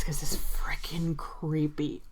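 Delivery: slow, hushed emphasis; creeped-out whisper. Real, not acted. Dwelling on creepy game lore.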